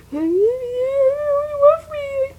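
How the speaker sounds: high strained laugh breaking into a sob; a choked high-pitched laugh; laugh-cry. Real, not acted. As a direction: crack up and half cry. Breaks down telling the story.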